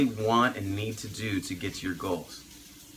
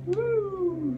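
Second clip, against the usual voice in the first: excited. Real, not acted. Showing final food prep.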